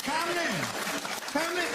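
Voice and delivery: high mocking call of a name; a touch of a posh politician; mock Cameron. Mocking Cameron for the audience, high.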